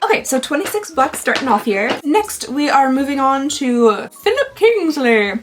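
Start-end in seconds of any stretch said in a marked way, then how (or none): 2.0-5.3 put-on slow, grand British accent on the brand name; pompous British accent. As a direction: announce it like a pompous Brit. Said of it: "a overly pompous British accent".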